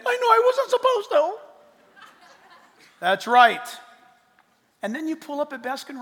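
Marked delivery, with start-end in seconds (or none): 0.1-1.4 put-on high, sheepish child voice with a laugh; small child. Child admitting fault to parent, high and sheepish. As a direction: confess like a guilty little kid.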